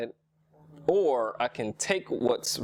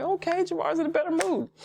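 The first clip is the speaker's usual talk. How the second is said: coworkers' pleased reaction. Retold or imitated. Coworkers seeing him back to normal, playfully approving. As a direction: imitate relieved coworkers in a raised pitch. Coworkers greeting his cheerful return.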